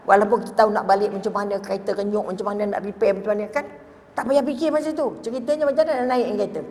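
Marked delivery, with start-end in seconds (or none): none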